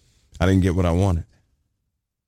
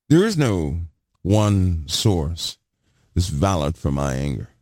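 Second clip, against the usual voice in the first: normal talking voice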